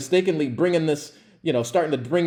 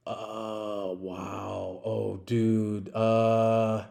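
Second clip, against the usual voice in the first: very soft, low, dazed reaction; stunned murmur; 5 semitones lower, 9 dB quieter, much slower than usual. Retold or imitated. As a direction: trail out words as if frozen with shock.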